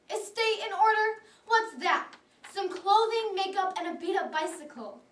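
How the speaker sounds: loud, high-pitched indignant disbelief; acted incredulity. Teen patient scoffing at doctor's advice, loudly incredulous.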